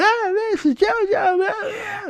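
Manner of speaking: piercing, very high screamed impression; mock scream, shrieking way up high and loud. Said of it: "all she does is fucking scream, like, and yell".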